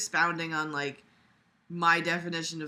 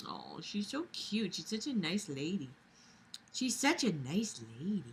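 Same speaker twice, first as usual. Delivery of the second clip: doting coo; 8 dB quieter than usual. Real, not acted. Speaker fussing over a cutie patootie, soft cooing. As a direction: coo softly over someone adorable.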